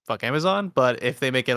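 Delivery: ordinary talk, nothing marked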